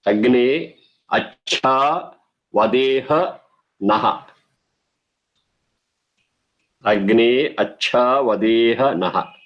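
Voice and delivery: word-by-word recitation. Shown as a demonstration.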